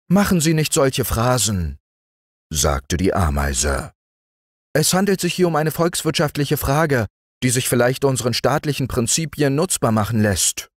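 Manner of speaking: high, fast, lecturing ant voice. A narrator's reading. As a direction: talk down to the mayfly as a prim ant. Ant scolding mayfly.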